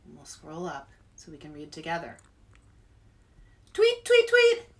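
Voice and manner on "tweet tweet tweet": high, loud chirpy bird calls; bird tweets. Acted. Author reading to kids, chirping the bird high.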